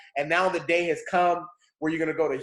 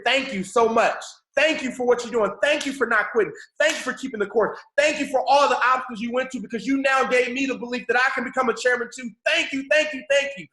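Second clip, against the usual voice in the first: emotional screaming thanks; 7 semitones higher than usual. Pretend. Team thanking their leader on stage, screaming gratitude. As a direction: cry out thanks like a grateful fan.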